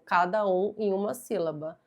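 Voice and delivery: ordinary talk, nothing marked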